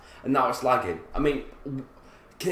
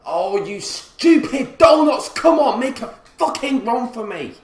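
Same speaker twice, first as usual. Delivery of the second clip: exasperated yell; 8 semitones higher, 7 dB louder than usual. Real, not acted. Gamer berating his players, exasperated yell. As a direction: yell at teammates who will not move.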